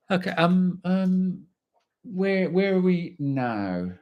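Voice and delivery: lightly voiced puzzled instructor; unsure old instructor. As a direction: play the lost instructor dithering over the chart. Instructor unsure of position, quoted.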